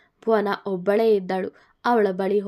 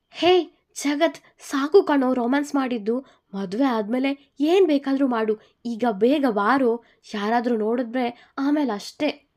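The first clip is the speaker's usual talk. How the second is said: hurrying call; 4 semitones higher, 3 dB louder than usual. Acted. Friend hurries the lovers.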